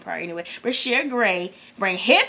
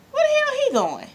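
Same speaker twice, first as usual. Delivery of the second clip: high, squeaky bewilderment; baffled reaction; much faster than usual. Retold or imitated. Viewer watching Bobby leave the stage, baffled. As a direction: pitch it way up in disbelief.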